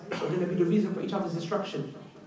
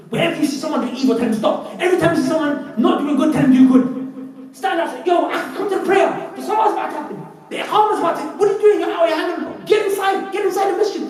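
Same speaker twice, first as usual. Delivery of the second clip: calling out orders; 12 semitones higher, 7 dB louder than usual. Retold or imitated. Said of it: "So he said".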